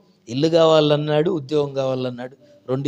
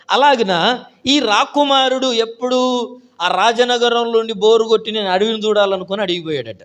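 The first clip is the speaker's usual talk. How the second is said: high, loud, stretched preaching voice; fervent preaching; 8 semitones higher, 5 dB louder than usual. Acted. Preacher telling the prince story, fervent and loud. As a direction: lift the congregation with the story.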